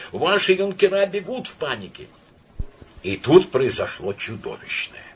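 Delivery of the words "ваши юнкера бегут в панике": high, urgent warning cry; panicked report. A narrator's reading. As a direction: shout the alarm, flustered. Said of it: "закричал ему навстречу".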